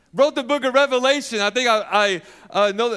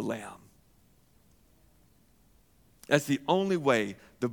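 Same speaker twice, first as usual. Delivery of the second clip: hushed; 11 semitones lower, 9 dB quieter than usual. Real, not acted. Preacher hushes the crowd about the cross, soft and low. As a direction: drop to a near-whisper, soft and low. Hushed climax of sermon point.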